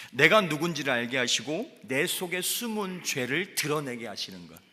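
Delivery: high, loud fervent preaching; preaching at full force. Acted. Pastor preaching to the congregation at full force. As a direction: drive it home to the congregation.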